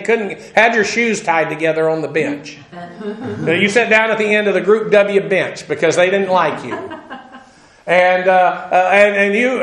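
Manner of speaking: ordinary talk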